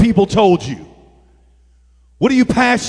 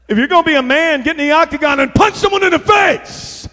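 raised, high preaching yell; macho exhortation; 8 semitones higher, 4 dB louder than usual. Real, not acted. Preacher hyping UFC over boxing, macho shout. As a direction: fire up the crowd with mock bravado.

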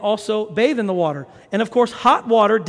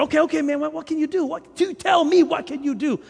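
higher-pitched, insistent street-seller plea; pleading haggler; 5 semitones higher than usual. Acted. Watch seller bargaining with a reluctant customer, pleading. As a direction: press the buyer for an offer, voice lifted.

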